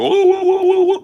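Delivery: loud, high imitation war whoop; mock war whoop. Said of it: "fake feathered headdress and go".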